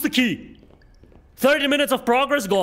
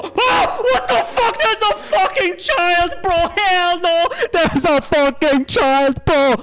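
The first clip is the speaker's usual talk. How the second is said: high, loud panicked yelling, slowing down; terrified shout; 7 semitones higher, 5 dB louder, slower than usual. Real, not acted. Gamer spotting a child in the maze, panicked high shouting. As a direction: scream it out in real fright. Sees a child in horror game.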